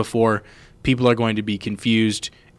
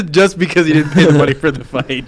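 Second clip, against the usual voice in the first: laughing; 5 semitones higher, 4 dB louder than usual. Real, not acted. Laughs at friend's joke.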